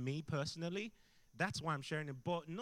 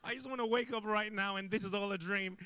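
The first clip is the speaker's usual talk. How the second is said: mock desperate prayer; 6 semitones higher, 5 dB louder than usual. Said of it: "I was thinking".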